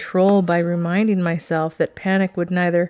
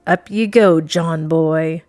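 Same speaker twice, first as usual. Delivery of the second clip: gruff pirate; 6 dB louder, slower than usual. A narrator's reading. Pirate hauls captive up.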